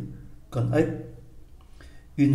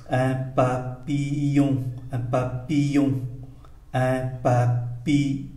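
slow, syllable-by-syllable "papillon"; slow reading; 3 dB quieter than usual. Shown as a demonstration. Teacher models French for learners, slow "papillon". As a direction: draw out "papillon" for learners, each syllable clear. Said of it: "tôi đọc chậm".

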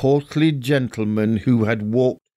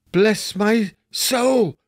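slow, higher exclamation; startled cry; 9 semitones higher, much slower than usual. A narrator's reading. Fred startled by the face at the door, slow and higher. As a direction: caught completely off guard. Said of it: "cried Fred".

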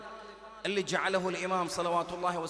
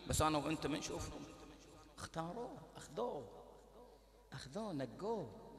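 normal talking voice

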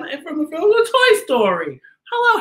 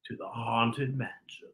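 ghost host voice; 13 semitones lower, 15 dB quieter than usual. Acted. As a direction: welcome them like a spooky host.

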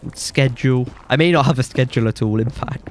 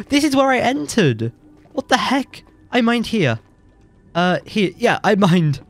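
confused, raised pitch; baffled high voice; 8 semitones higher than usual. Real, not acted. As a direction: puzzle it out in disbelief. Confused, portal missing.

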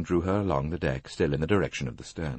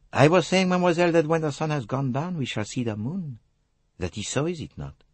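Hercule Poirot; 10 semitones higher than usual. Acted. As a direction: explain the riddle courteously in a French accent.

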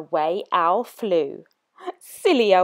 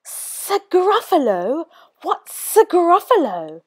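puzzled snake; 9 semitones higher than usual. Acted. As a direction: draw it out like a baffled snake. Snake asks, drawn out.

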